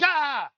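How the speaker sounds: high, loud monster shriek; monster roar. Pretend. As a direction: let out a sudden monster yell. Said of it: "Monster voice".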